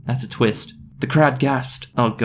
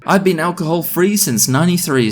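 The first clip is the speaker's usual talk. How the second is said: higher-pitched mild mock scream; screamed confession; 6 semitones higher than usual. Mum confessing to the party, mock screaming. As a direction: call it out, pitched up. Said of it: "screamed my mum".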